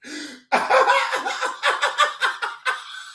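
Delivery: long, high fit of real laughter; can't stop cackling high. Real, not acted. Laughing hard at the story, high.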